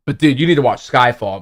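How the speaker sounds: ordinary talk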